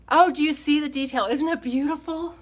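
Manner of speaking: thrilled showing off the carving; delighted gush. Real, not acted. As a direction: gush over the detail. Showing off carved detail on camera.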